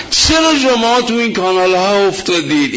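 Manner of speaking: ordinary delivery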